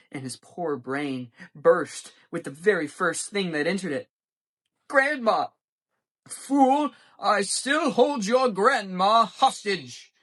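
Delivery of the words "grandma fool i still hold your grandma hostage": high, loud, slow villain roar; villain shout. A narrator's reading. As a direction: bellow the threat like a cartoon villain.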